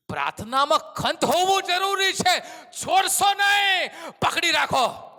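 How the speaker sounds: loud, high preacher's cry; rising revival sermon shout; fiery preaching. Acted. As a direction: preach it out with full force. Preaching at full force.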